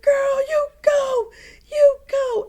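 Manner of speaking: running partner yelling cheers; cheering shout. Retold or imitated. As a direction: scream encouragement from behind. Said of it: "he started shouting behind me".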